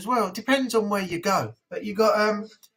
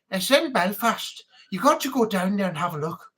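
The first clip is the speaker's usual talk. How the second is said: chatty Irish lilt; Irish patter, urging someone to visit; Irish accent. Shown as a demonstration. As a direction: put on a friendly Irish accent.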